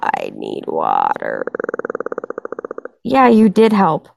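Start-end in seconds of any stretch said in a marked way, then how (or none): 0.0-1.4 much lower, slow, croaking rasp; croaky deep voice. Acted. To the chat, hamming up a lost voice in a deep slow croak. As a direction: let the voice sink into a dried-out croak. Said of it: "I sound like a man".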